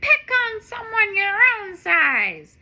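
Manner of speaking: loud, high tough cat; defiant. Acted. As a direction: stand up to the bully. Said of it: "Streaky says".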